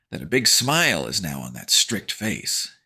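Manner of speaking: ordinary delivery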